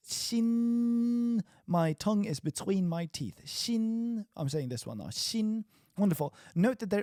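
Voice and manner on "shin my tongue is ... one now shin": shin said high with the tongue between the teeth; shin demo. Shown as a demonstration. Teacher showing the tongue position for shin, high. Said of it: "And I'm like".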